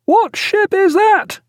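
high, slow shouted question; drawn-out call over the water; hailing question. A narrator's reading. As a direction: hail the other ship for its name.

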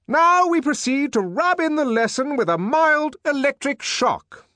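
high, loud, sustained shout; shouting over noise. Acted. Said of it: "the director shouted, for the noise was deafening".

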